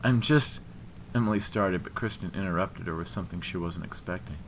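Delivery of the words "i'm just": faltering protest. A narrator's reading. Emily starts and is interrupted.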